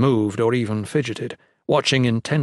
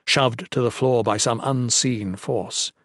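ordinary delivery

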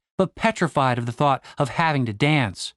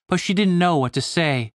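usual speaking voice

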